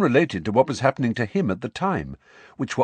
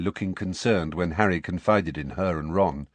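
ordinary delivery

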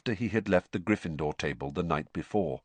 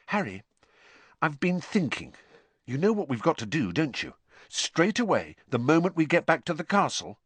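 Hermione voice; 9 semitones higher than usual. A narrator's reading.

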